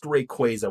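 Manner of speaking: "Rayquaza" said ray-KWAY-za; "Rayquaza" with a long A in the middle; official pronunciation. Shown as a demonstration. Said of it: "it's pronounced".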